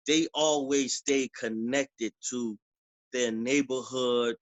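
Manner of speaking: usual speaking voice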